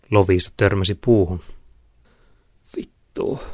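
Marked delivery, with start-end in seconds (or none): 2.8-3.4 pained curse. A narrator's reading. Girl has hit a tree.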